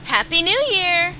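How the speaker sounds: loud, high celebratory shout; jubilant cheer. Acted. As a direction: shout the greeting with glee. Hiyoko cheers the new year.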